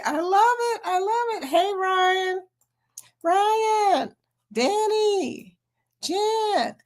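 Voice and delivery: high, excited calling of names; high thrilled greetings; gushing high voice. Real, not acted. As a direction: gush over each name.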